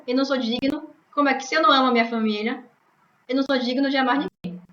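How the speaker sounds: usual speaking voice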